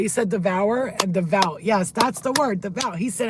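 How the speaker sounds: usual speaking voice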